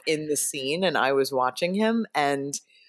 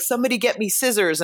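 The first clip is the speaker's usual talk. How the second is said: quick, higher mock director's call; urgent director; 7 semitones higher, 3 dB louder, faster than usual. Director calls to crew for scissors, urgent retold order. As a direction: imitate a hurried director demanding a tool.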